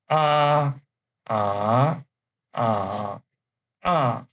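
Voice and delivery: ah level, rising, dipping, then falling; ah in four tones. Shown as a demonstration. Teacher reading the row aloud, ah through four tones. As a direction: run ah through all four tones in turn. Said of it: "pay the attention, uh, to the tones".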